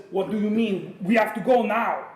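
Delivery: hammed-up Russian bear voice; Russian accent. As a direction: push out a clumsy, urgent Russian accent. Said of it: "he's Russian, he's gonna talk like the big Russian bear".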